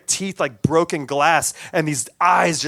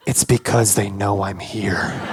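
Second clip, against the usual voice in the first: ominous inner thought; 8 semitones lower than usual. Retold or imitated. Paranoid private thought, voiced low.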